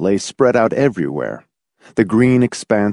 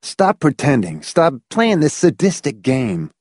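hoarse, raised-pitch protest; 6 semitones higher than usual. A narrator's reading. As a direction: plead hoarsely for it to stop. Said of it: "Hamilton said hoarsely".

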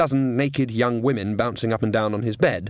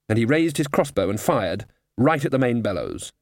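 plain reading voice

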